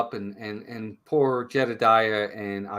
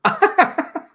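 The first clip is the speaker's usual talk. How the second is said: high laugh; 7 dB louder than usual. Real, not acted. Still laughing at the joke.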